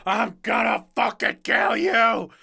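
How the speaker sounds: high, loud, drawn-out shout; imitated screaming. Homeless man screaming at apartment buildings, imitated shout. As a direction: scream the threat like a raving stranger. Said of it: "he's just screaming".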